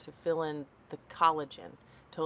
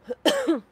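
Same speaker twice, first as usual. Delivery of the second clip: sudden sharp coughing fit; choking cough; 10 dB louder than usual. Real, not acted. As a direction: cough hard, choking on tea. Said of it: "I'm choking".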